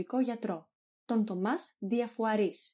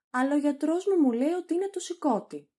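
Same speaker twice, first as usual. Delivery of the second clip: loud, high, quick objection; hurried protest, voice raised; 5 semitones higher, 5 dB louder, faster than usual. Acted. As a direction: contradict the doctor in alarm. Patient contradicts the examining doctor.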